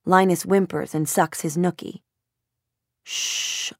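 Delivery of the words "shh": sharp whispered shh; scolding shush. A narrator's reading. Mother hushing noisy Charlie with a stern whispered shh. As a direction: hush the boy firmly. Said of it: "scolding Charlie".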